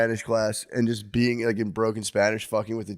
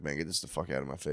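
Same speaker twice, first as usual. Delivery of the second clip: mock dismissive; 6 semitones lower, 10 dB quieter, faster than usual. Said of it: "I'm like".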